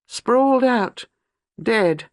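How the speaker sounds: high, slow, shaken telling; young wife recounting a vision of murder, high and shaken; horrified recollection. A narrator's reading. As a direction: relive the horror, voice climbing. Horror recalled, high pitch.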